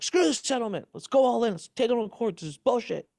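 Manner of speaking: high, pumped-up mock rant; imitating his riled-up wife; fired-up defiance. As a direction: egg him on to fight in court. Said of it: "She's like".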